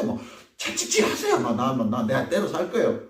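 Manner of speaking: mock the rebuke, laughing; laughing mockery. Preacher mimicking a believer laughing off God's rebuke, mocking.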